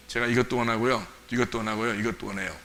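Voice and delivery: low, soft listing, acted; 조용한 요구. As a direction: quietly put in each request, pretending to ask. Acted list of demands to Jesus. (낮고 작게 조르는 척)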